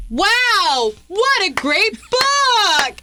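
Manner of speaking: high, drawn-out fake wonder; fake nonchalant. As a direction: feign surprise, loudly casual. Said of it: "I'm like".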